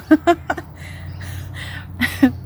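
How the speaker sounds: high breathy giggle; a light amused laugh; kind gentle laugh. Real, not acted. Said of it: "I'm laughing at the fact".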